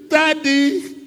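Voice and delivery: high, drawn-out call; plaintive high call; anguished calling out. Acted. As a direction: cry out for Daddy on a high held note. Acted cry to the father.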